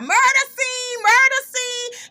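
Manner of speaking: high, loud, slow sing-song taunt; mocking taunt. [name] taunting in the background, high loud sing-song. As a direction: chant it up high and loud like a jeer.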